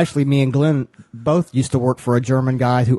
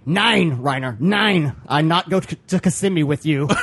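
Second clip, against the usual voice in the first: put-on higher, emphatic refusal in broken German-accented English; mock German accent; 4 semitones higher than usual. Bar worker turning down Reiner's invitation, mock German accent. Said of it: "I had to s-, learn to say".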